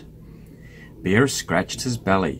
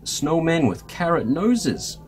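higher, mildly pleading cry; 6 semitones higher than usual. A narrator's reading. Mouse tempts sleepy Bear to stay up, crying out. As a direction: plead a little, pitched up. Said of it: "cried Mouse".

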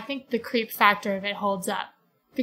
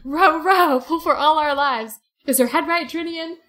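loud, high orders to row; panicked Caspian; 5 semitones higher, 5 dB louder than usual. Retold or imitated. Caspian shouts at the rowers to flee the dark. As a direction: shout for the oars in fear. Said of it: "the Caspian's like".

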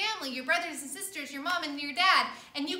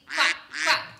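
loud quacking like a duck; 7 dB louder than usual. Shown as a demonstration. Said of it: "quacking like a duck".

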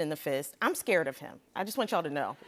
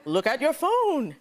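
slow, laughing put-on magician voice; David Blaine impression; 4 semitones higher, 3 dB louder, slower than usual. Quoting David Blaine's reveal, slow laughing put-on voice. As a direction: draw it out like a mysterious magician. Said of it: "if you close your eyes, it's like David Blaine's right here".

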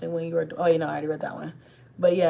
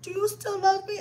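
put-on little girl asking; 10 semitones higher, 4 dB quieter than usual. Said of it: "She'd be like".

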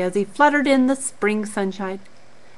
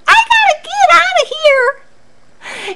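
Charlie's shout; 4 dB louder than usual. Acted. Charlie escapes the monkeys.

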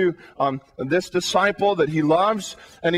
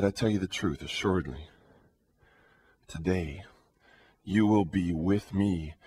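solemn hushed promise; 8 dB quieter than usual. Acted. Jesus's solemn promise to thief.

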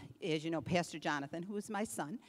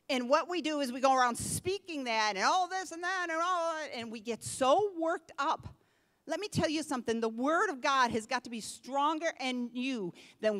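loud, raised sermon exhortation; loud fiery preaching; preaching at full force; 5 semitones higher, 6 dB louder than usual. Acted. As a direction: press the congregation hard.

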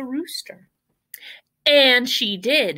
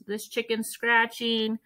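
ordinary delivery, nothing marked

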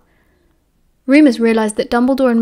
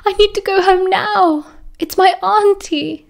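high, slow tearful pleading; sobbing plea; 9 semitones higher than usual. A narrator's reading. Tina begging the professor to go home, tearful and high. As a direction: beg through tears to be let go. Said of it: "Tina sobbed".

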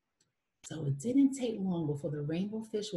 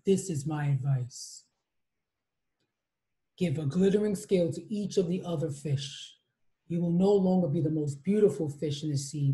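deep voice; 3 semitones lower than usual. Acted. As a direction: speak like an ancient sea creature, low. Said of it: "said the octopus in a deep voice".

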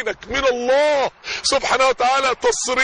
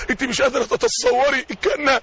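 usual speaking voice

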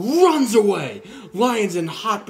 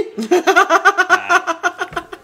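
high loud burst of laughter; big laugh; 13 semitones higher, 7 dB louder than usual. Real, not acted. Teller laughing at his own punchline, loud high laugh. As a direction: crack up at your own joke.

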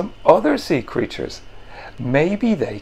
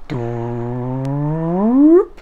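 happy doop. Acted. Finn making a sound he likes, a long rising doop. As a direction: draw out a pleased doop. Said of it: "another sound which he really liked".

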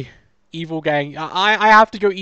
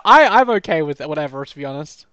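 usual speaking voice

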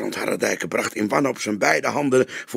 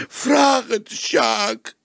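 very slow, much higher sobbing plea; broken journalist begging once more, crying; childlike wail; 5 dB louder, much slower than usual. A narrator's reading. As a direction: drag it out through tears. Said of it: "Hij huilde als een kind".

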